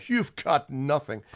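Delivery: mock-smug dismissal; God. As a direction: drag out the put-down. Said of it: "God just comes off as this obnoxious".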